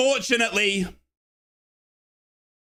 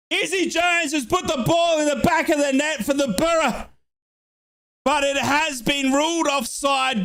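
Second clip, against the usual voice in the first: worked-up commentary; 4 semitones higher than usual. Real, not acted. Fan commentator after the disallowed goal, raised voice. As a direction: call it still hyped, then deflate.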